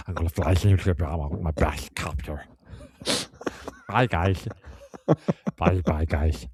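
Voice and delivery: harmless mock hero saying farewell; least threatening Batman. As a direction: parody a superhero accent gone badly astray. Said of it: "least threatening Batman of all times".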